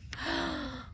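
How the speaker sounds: a short, sharp gasp of alarm. Acted. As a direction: catch your breath in surprise. Storyteller reacts to the seed.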